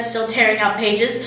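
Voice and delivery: ordinary delivery, nothing marked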